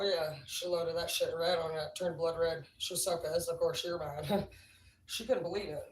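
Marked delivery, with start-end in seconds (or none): none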